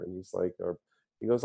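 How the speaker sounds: normal talking voice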